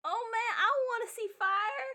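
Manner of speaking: put-on high-pitched, eager child voice; excited kid. Kid begs to see fire, excited child voice. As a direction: play a kid itching for a spectacle.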